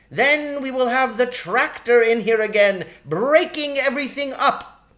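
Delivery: a tiny sharp-tongued shrew, pitched high; sharp lady shrew. Acted. Shrew complains about the tractor.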